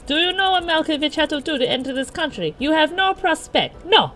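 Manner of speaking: high, indignant character rant; screamed accented tirade. Acted. John berating a catcaller, screaming tirade. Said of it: "screaming at him in that peculiar accent".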